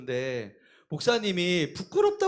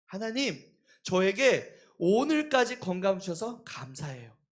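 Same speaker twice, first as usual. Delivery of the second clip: much softer, sincere thanks to God; grateful prayer; 5 dB quieter than usual. Retold or imitated. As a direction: pray it gently, with real gratitude. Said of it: "고백을 하나님께".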